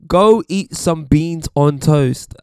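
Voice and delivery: slow, loud, hammed-up taunt; mock American heckler. Voicing Americans' classic jibe at Brits, loud slow mock taunt. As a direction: draw out the jibe, loudly. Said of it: "the one that Americans always go for".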